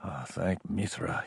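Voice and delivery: low, slow mutter; hushed, drawn-out aside; muttered relief. A narrator's reading. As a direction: mutter thanks to yourself, very softly. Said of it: "he muttered".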